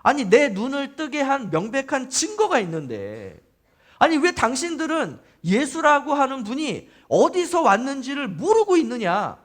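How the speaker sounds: exasperated. Acted. Healed man challenging the Pharisees, exasperated. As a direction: protest as if the proof is obvious. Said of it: "굉장히 지금 답답해하는 거죠".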